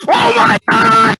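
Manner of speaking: sudden high yelp of shock; startled shout. Real, not acted. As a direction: jump out of your skin. Sudden jump scare.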